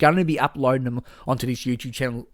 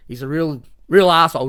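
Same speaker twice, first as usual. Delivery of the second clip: ordinary talk, nothing marked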